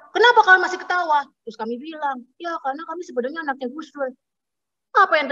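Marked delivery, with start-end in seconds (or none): 0.1-1.2 high, quick mock outrage; angrier taxi driver. Taxi driver rounds on passengers still laughing, mock furious. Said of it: "Terus dia makin marah". 2.4-4.1 high, soft, hurried admission; small sheepish reply. Retold or imitated. Daughters confess to the angry taxi driver, meekly.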